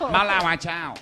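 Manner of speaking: high, laughing fake Chinese gibberish; pretend Chinese. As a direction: babble made-up Chinese through laughter. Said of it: "get back to pretend Chinese".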